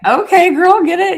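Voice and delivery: put-on high, loud girly hype; mock cheer. Cheering friend on as she drives, high hype.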